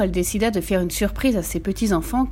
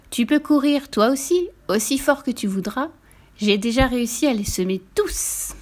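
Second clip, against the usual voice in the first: higher, mildly raised taunting call; 5 semitones higher than usual. A narrator's reading. Gingerbread man taunts the horse, calling out. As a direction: call a light taunt, stretching up at the end. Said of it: "lui cria".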